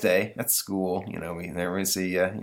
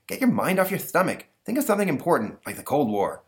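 put-on higher, lisping kid voice; lisping boy Stanley, exasperated; 7 semitones higher than usual. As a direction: talk like an exasperated boy with a lisp.

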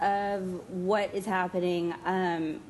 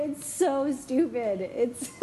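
laughing; 5 semitones higher, slower than usual. Real, not acted. Giggling while telling a joke.

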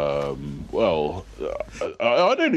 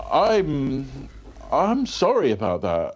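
ordinary delivery

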